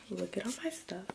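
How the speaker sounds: hushed whisper; breathy aside in a whisper. Real, not acted. Whispering aside while packing.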